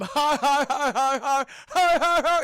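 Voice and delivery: loud, high forced cackle; hammed-up jeering laughter; bully's laugh. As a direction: laugh long and loud at your victim. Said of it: "You hear him before you see him".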